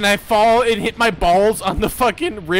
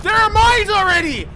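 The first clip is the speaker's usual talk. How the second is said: alarmed; 10 semitones higher than usual. Real, not acted. Alarm at mines.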